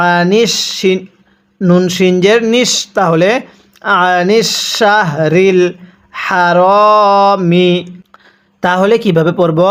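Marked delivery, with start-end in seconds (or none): 4.1-7.7 হারামি with the হা breathed out from the mouth, slowly; breathy h sounds. Shown as a demonstration. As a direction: stretch হারামি, airy হা at the start. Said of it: "এই হাটি উচ্চারণ করার সময় মুখ দিয়ে বাতাস বের করে পড়ছি". (হারামি শব্দে হা বাতাস বের করে উচ্চারণ)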